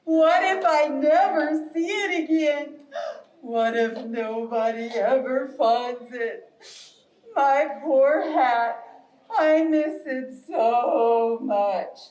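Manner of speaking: slow, drawn-out lament of a bear; sad bear. Acted. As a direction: moan it slowly like a forlorn bear. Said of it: "Bear's just laying on the ground going".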